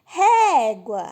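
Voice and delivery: Régua with a loud, drawn-out "ré"; stress on first syllable. Shown as a demonstration. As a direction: punch and hold the "ré" in Régua. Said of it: "todas essas, a sílaba mais forte".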